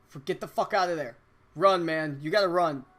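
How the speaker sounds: loud frantic warning; panicked shout. Acted. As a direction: yell urgently to flee. Panicked warning in horror chat.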